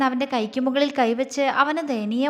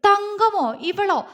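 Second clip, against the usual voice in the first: incredulous; 8 semitones higher, slower than usual. Acted. Arun's eyes widen in disbelief.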